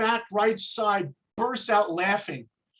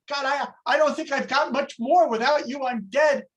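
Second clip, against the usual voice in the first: praying drowning man; 6 semitones higher than usual. Acted. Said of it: "he begins to pray".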